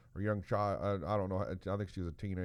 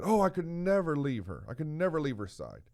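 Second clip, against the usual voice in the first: higher, louder mock protest; imitating distressed mother; 5 semitones higher, 3 dB louder than usual. Mother refusing to leave her dying girl, imploring. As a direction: plead in a raised, imitated mother's voice.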